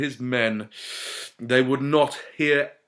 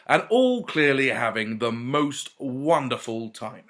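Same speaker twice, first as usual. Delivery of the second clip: ordinary delivery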